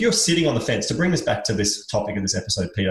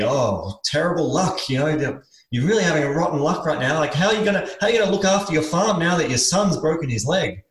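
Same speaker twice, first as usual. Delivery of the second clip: higher-pitched, fretting sympathy; commiserating neighbour; 5 semitones higher than usual. Acted. Neighbours pitying the farmer over his son, commiserating. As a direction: fuss over someone's rotten luck. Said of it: "Again, the neighbors say".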